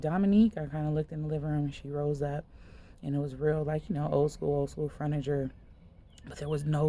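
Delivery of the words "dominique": loud call, raised pitch; calling a name. Retold or imitated. Visitor calling Dominique in the dim living room, loud.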